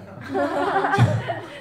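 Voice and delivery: high bright laugh at the punchline; a real amused laugh from the pulpit; burst of laughter. Real, not acted. Preacher laughs at own story.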